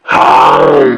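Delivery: deep, breathy lion gulp; a big low chomp; lion chomping sound. Acted. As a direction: swallow the goat whole with a growl. Lion gulps down the goat.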